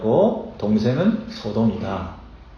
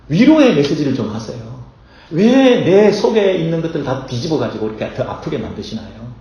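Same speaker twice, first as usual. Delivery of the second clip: loud, raised pleading complaint; pleading protest; 3 semitones higher, 4 dB louder than usual. Pretend. Congregant protesting to Ezekiel, loud and pleading. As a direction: protest to the prophet, hurt and loud.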